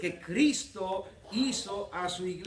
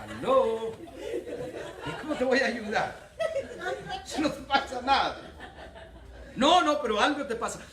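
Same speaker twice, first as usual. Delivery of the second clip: exasperated husband; 4 semitones higher, 4 dB louder than usual. Retold or imitated. Retold quarrel, husband pressing.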